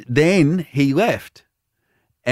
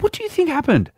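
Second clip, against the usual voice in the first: very high, baffled questioning; squeaky-high innocent challenge; retold incredulity; 13 semitones higher, faster than usual. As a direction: send the pitch right up in mock disbelief.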